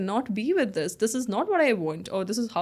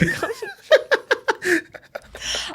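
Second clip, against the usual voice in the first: long high laughter. Real, not acted. Laughs at the copying claim.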